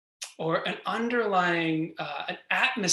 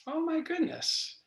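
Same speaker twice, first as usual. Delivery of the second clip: mock surprise; 11 semitones higher, 5 dB quieter than usual. Mock self-talk catching a thought.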